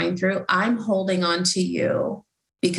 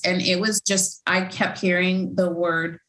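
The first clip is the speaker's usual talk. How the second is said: usual speaking voice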